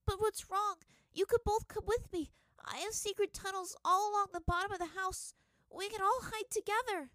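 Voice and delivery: put-on high, loud desperate little-girl plea; girl pleading with her parents; frightened pleading. A narrator's reading. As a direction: beg them to come along.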